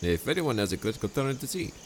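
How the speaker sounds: Montresor, falsely friendly. Acted. Montresor dialogue.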